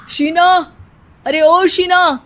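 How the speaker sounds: loud, high call across the house. A narrator's reading. Husband calling Sheena from afar, loud. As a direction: shout her name from another room. Sahil calls out.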